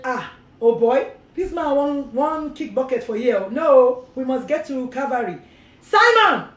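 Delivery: Nigerian pidgin, shouting orders, ending in a loud call; Nigerian pidgin crucifiers. Retold or imitated. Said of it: "his crucifiers said".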